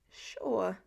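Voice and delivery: a hint of a rough boy's voice; one dry word from a rough throat; gravel voice. A narrator's reading. Pete to Clint, patronizing, lightly gravelly. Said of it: "said Pete in his gravel voice".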